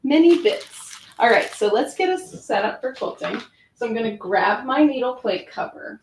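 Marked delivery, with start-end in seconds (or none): 0.1-1.0 playful posh English "Many bits", higher and louder; a jokey British-accent aside about the bits; mock English accent. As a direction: say it like a cheeky Brit. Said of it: "I have to say that in an English accent".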